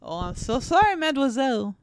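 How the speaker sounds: high, loud, badly faked French accent. Boyfriend apologising to mademoiselle, clumsy French accent. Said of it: "French accent".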